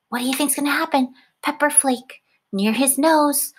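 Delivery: ordinary talk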